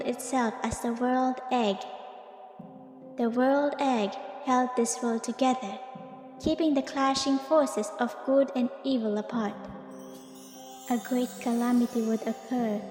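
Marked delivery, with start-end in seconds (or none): none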